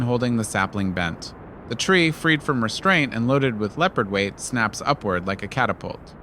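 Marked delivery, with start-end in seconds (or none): none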